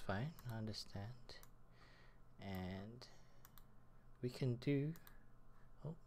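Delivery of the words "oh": ordinary talk, nothing marked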